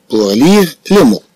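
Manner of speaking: slow, clear reading of "pour lire les mots"; slow dictation. Shown as a demonstration. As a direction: spell it out slowly for the class.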